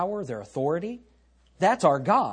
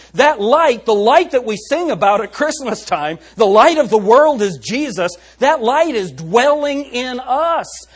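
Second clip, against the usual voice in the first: full-force preaching; 7 semitones higher, 7 dB louder than usual. Acted.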